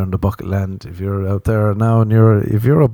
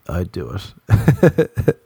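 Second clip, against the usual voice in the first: slow English buddy; 3 semitones lower, much slower than usual. Retold or imitated. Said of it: "He was like".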